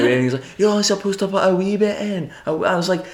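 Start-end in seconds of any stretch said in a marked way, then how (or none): 0.6-2.3 high, complaining Scottish voice; Scottish accent. Retold or imitated. Scottish customer scolding the bartender, high Scottish accent. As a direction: imitate an exasperated Scot, pitched high. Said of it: "these Scottish fellas came up".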